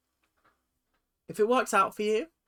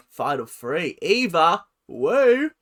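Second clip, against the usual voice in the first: ordinary delivery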